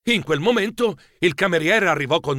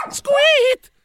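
short, loud high squeak; animal squeak; 5 dB louder than usual. Acted. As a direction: yelp out a sudden squeak. Verso improvviso di animale. (squittio acuto e improvviso)

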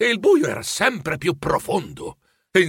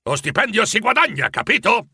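higher, insistent lecture to the clerk; stern scolding; 7 semitones higher than usual. A narrator's reading. Scrooge lectures Bob about wages, sternly. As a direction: drive the point home like a stingy boss.

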